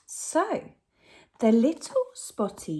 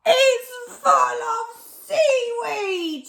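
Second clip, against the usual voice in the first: much higher and louder shocked shout; 7 dB louder, slower than usual. Acted. Len finds seaweed in the sack, loud high shout. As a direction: cry out in dismay. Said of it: "shouted Len".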